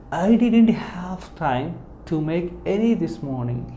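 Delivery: any said with a clear falling tone, the whole line slow. Shown as a demonstration. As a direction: drop your voice on the word any. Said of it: "It's a falling in your tone".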